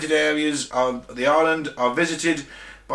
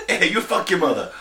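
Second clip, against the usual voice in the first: mock Indian accent; 3 semitones higher than usual. Host trying an Indian accent while laughing. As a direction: fling it in a jokey Indian accent. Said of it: "That's better".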